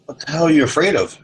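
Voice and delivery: the cop asks why he needs all those guns, incredulous; cop's question. Retold or imitated. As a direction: push the question up in disbelief. Cop asking the speaker.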